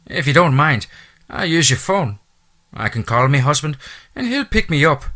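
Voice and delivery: high, loud Irish housekeeper voice; chatty Irish brogue, pitched high. Acted. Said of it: "her lyrical accent".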